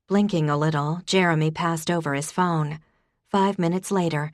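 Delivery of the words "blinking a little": plain reading voice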